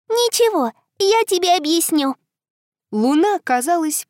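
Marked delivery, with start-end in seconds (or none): 0.1-2.2 put-on little girl voice. Girl answers.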